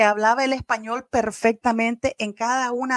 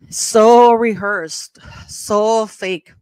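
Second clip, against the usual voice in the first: louder, very slow contempt on "So rehearsed"; scornful verdict; 6 dB louder, much slower than usual. Real, not acted. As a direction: drag out "So rehearsed" with disdain, then drop it on "so fake". Mocking the couple's staged speech.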